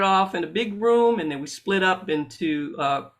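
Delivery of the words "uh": ordinary talk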